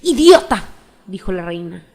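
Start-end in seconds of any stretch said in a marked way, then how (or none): 0.0-0.6 loud, much higher insult spat out; impatient outburst. A narrator's reading. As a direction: snap it at the fool, impatient queen. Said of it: "agitando la cabeza con impaciencia".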